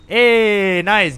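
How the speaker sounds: a happy little cheer; pleased exclamation. Real, not acted. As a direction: call it out with real delight. Spots cosplayer in game.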